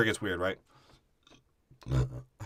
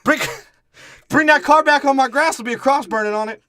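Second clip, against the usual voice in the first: high, loud sassy lady voice, hammed up; shrill it back at him like an offended neighbor; 12 semitones higher, 10 dB louder than usual. Lady yelling about car on grass.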